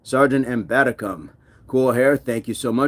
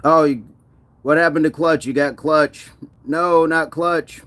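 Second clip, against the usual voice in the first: higher, louder dismay; surprised; 4 semitones higher, 4 dB louder than usual. Real, not acted. Streamer reacting to a viewer timing out Clutch, surprised. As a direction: cry out that Clutch got timed out.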